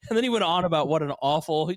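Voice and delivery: ordinary talk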